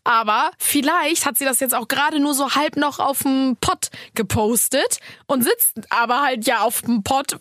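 ordinary delivery

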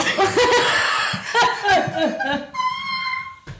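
high shrieking burst of laughter. Real, not acted. (lautes kreischendes Lachen)

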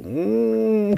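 high, drawn-out "mmm"; savouring hum. Acted. Picturing a plate of Indian curry, delighted savouring hum. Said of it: "denk nur so".

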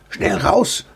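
a mild, voiced urgent hiss. A narrator's reading. Mouse urging his companion out the door, urgent hiss. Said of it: "zischte Edi".

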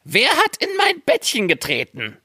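high, indignant dwarf voice; first dwarf. A narrator's reading. First dwarf spots the dent in his bed, high voice. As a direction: pipe up high about the intruder. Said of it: "Da sprach er".

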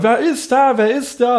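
put-on high, loud puzzled old man; confused old man. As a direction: ask it like a baffled old man. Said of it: "und immer".

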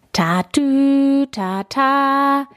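high siren call Tatü tata; siren imitation. A narrator's reading. Siren sound in story.

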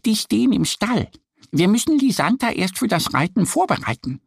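high duck voice, patiently explaining; little duck who knows the stable routine. A narrator's reading. Annie explaining to Mo that they must prepare Lisanta, high duck voice. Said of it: "erklärt Annie".

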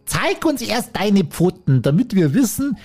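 suspicious goats demanding; slightly high slow childish voice; little goats. Acted.

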